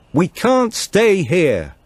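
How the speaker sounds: high, loud, very slow call over the wind; shouted back. Acted. Tilda warns Martin they must leave the cars, shouting. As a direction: stretch each word so it carries through the storm. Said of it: "shouted back".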